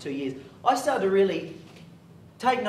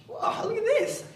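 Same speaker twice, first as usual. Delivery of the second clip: much higher, thrilled exclamation; excited wow; slower than usual. Retold or imitated. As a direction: gasp it out as if the award just arrived. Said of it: "So I got all excited".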